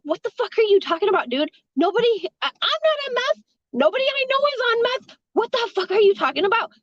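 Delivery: high-pitched, incredulous retort; retold indignation, pitched well up; indignant denial. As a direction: act out protesting a false accusation. Retorting to a meth accusation.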